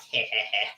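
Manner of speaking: low mock sinister heh heh heh; sadistic chuckle. Reader imitating the client's low sadistic chuckle. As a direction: chuckle darkly, like you got the last laugh. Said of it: "a little bit of a sadistic laugh".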